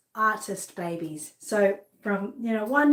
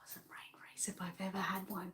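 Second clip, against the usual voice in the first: breathless whisper after the pain; 2 semitones lower, 17 dB quieter than usual. Real, not acted. Whispering to viewers after a painful brain freeze. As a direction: whisper it, still recovering.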